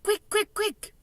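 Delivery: high, hurried repeated urging; urgent. A narrator's reading. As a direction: hurry her along. Urging Sophie to hurry.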